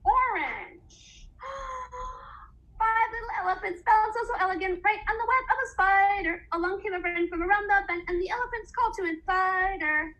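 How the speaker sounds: lively, fast storybook rhyme read aloud; reading to children. Acted. Teacher reading a counting rhyme to little ones, lively. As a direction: count the elephants out like a picture book.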